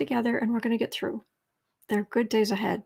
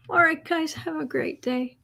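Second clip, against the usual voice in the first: ordinary talk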